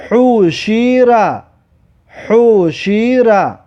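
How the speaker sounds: slow, joined syllables with held vowels; drawn-out model reading. Shown as a demonstration. Teacher models a word for learners, slow and drawn out. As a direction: stretch each vowel of "حوشیرا".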